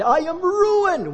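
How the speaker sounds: high, slow wail of ruin; anguished cry. Retold or imitated. Isaiah before the holy God, high slow wail. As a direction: cry out "ruined" as if all is lost. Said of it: "I cried".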